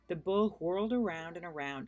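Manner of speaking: ordinary delivery, nothing marked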